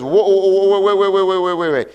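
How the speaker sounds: hammed-up devil crying whoa, wait; the devil panicking as believers learn who they are, mock alarm; startled devil. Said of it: "And the devil says".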